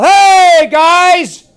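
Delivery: loud high call across the room; big friendly high-pitched yell. Shown as a demonstration. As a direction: shout it out to grab their attention. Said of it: "If I yell".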